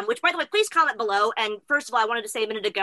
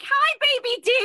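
put-on high squealing baby talk; squealing baby talk aunt; 3 dB louder, slower than usual. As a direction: coo at him like a doting aunt. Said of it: "We'll be like".

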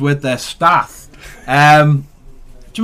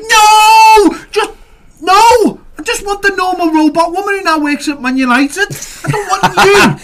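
mock refusal; 5 dB louder, slower than usual. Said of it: "and you were like".

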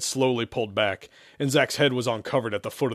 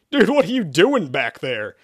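higher, louder bewildered yell; shouted question; 5 semitones higher, 5 dB louder than usual. A narrator's reading. Zach unable to see his rear, shouting. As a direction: yell back in alarm. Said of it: "Zach shouted".

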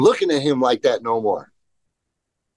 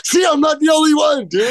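excited outburst; 12 semitones higher than usual. Real, not acted. Hearing the co-host skipped vert parts too, a vindicated shout. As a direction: yell it, finally proven right.

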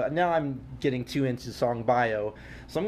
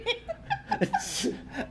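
excited giggle; 4 dB quieter than usual. Real, not acted. Excited laughing at show start.

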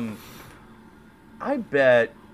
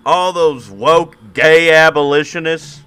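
mock rant; 6 semitones higher, 7 dB louder than usual.

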